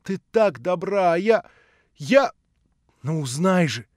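loud, high, impassioned confession; rapturous remorse. A narrator's reading. Alyosha confessing to Natasha in the flat, remorseful outburst. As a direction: confess in a gush of repentance. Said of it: "с восторгом и с раскаянием".

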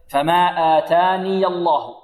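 slow chant, آتاني with ya voweled into الله; joined, vowelled ya. Shown as a demonstration. Teacher showing students the joined reading, slow chanted demo. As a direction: link آتاني to الله through a short a on the ya. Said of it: "يثبت الياء وصلا متحركة".

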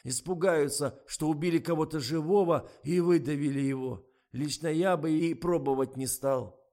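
snuffly, insistent objection; stuffed-up voice. A narrator's reading. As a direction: argue on through a head cold. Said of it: "вступил в разговор простуженный Миша".

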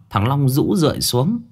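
usual speaking voice

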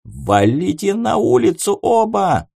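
high shout. Acted. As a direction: yell them outside. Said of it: "заорал Марли".

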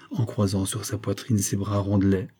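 ordinary delivery, nothing marked

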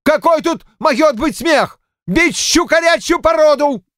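high-pitched indignant outburst; fierce yelling. A narrator's reading. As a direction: yell indignantly, voice pushed high. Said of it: "свирепо заорал". (свирепо орёт, высоким голосом)

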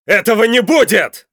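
furious high, loud shout; barked. A narrator's reading. Father defies the captor, barking in fury. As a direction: roar a flat refusal at full force. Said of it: "рявкнул Константин".

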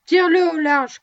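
loud, high command. A narrator's reading. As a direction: snap the order out, loud. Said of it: "commanda Scott".

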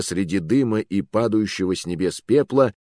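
plain reading voice

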